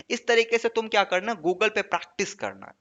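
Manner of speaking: usual speaking voice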